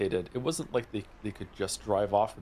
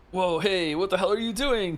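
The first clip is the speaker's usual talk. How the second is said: startled, higher sputter; 8 semitones higher than usual. Acted. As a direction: blurt it out, startled. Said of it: "Kano sputtered".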